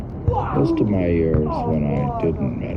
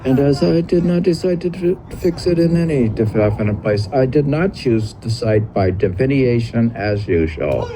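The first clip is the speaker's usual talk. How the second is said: higher literary recitation; reading old text aloud; 6 semitones higher than usual. Acted.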